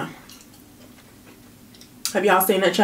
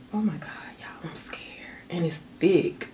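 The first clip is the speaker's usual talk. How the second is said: hushed aside; whisper; 11 dB quieter than usual. Real, not acted. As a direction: drop to a whisper. Whispered aside about thick sauce.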